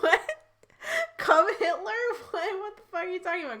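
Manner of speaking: high, laughing disbelief; mock-baffled outburst, laughing through it; incredulous reaction. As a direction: act out being totally thrown, pitch jumping up. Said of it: "I was like".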